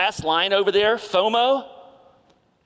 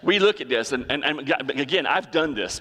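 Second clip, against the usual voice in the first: normal talking voice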